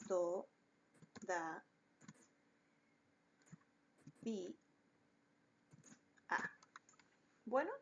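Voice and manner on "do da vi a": do, da, vi, a, each syllable spoken alone with long pauses; split into syllables. Shown as a demonstration. Teacher splitting todavía into syllables for her students. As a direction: clap out todavía one syllable at a time. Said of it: "voy a separarla".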